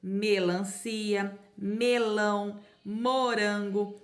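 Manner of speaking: "Melancia", "melão", "morango" each read very slowly; teacher slowly reading the fruit names; slow syllable demo. Shown as a demonstration. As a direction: sound out each fruit name for children. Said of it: "frutas que começam com M".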